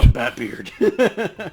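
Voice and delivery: playful superhero impression; mock Batman voice. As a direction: echo the joke in a hammed-up hero voice. Copying friend's mock Batman line.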